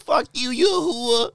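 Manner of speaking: put-on slow, high, loud Boston accent. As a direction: drag out a thick Boston accent. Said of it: "picture him in a Boston accent".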